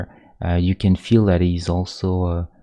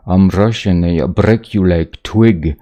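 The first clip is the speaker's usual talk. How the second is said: put-on silly Russian accent; 7 dB louder than usual. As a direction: ham up a cartoon Russian tough guy. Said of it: "do that really silly Russian accent".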